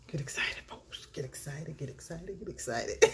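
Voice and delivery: hushed catchphrase. Acted. Hushed repeats of her catchphrase.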